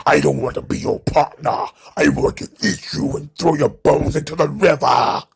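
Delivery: lightly voiced brute refusing; mock Omos voice. Omos rejecting Apollo Crews, lightly put-on brute voice. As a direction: growl the refusal like a giant. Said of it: "And then Omos is like".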